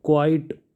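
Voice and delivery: क्वाइट with a long kwaait; quite contrast. Shown as a demonstration. Teacher contrasting क्वाइट with क्विट for students. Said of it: "तो देखो last में इसमें ई है".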